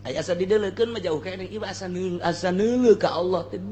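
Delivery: normal talking voice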